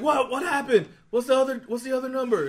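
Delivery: high, loud, excited re-enactment; retold frantic questioning. Gambler asking the table for the number, retold frantic questioning. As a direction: demand the result in mock frenzy.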